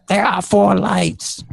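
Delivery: slow, high-pitched mock-dramatic declaration; dramatic quote. Quoting a famous dramatic line.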